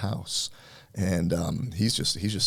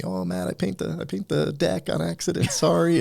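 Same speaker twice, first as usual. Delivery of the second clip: much higher, teasing imitation of a joking worker; mock crew clown; 11 semitones higher, 4 dB louder than usual. Teller imitating painter razzing his boss in a high teasing voice. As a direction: ham up the guy cracking jokes at the boss. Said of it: "he's always razzing me".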